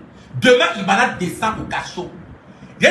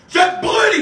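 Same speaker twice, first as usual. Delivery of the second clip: much higher, loud spell cry; mock shout; 9 semitones higher, 3 dB louder than usual. Burning Ibana in a mock spell, shouted high. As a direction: fling the curse out loud.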